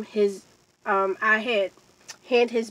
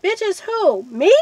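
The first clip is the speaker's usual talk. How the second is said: high incredulous challenge, louder on "Me"; indignant disbelief, retold; 8 semitones higher, 6 dB louder, slower than usual. Storyteller confronts him over the insult, high indignant disbelief.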